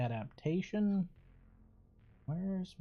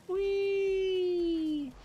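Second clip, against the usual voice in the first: long, high-pitched held squeal of "wheee"; playful whee; 3 dB louder than usual. Pretend. Player flung by a game slingshot, playful high whee.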